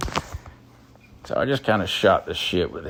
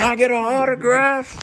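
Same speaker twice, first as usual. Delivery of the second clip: excited; 13 semitones higher, faster than usual. Retold or imitated. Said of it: "And I said".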